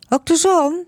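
put-on high, bright little girl's question; curious child. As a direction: ask it like a curious small child. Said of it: "vraagt Jeannette". (hoge kinderstem, nieuwsgierig)

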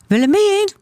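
high, sustained call. A narrator's reading. Boy calling his missing sister, high sing-out call. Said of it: "roept Gijsbert".

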